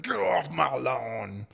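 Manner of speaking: a slow, hammed-up grumpy old lady. Ellie's mom shaking her fist at the Fae, slow mock grumble. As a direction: drag it out like a cranky neighbor. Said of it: "shaking her fist at them, like".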